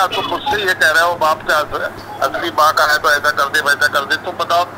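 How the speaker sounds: usual speaking voice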